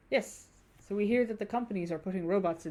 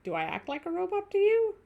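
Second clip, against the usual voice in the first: pitched up, offended question; high indignant; 11 semitones higher, 4 dB louder than usual. Shown as a demonstration. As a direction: squeak it in disbelief. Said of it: "repeated line, higher first".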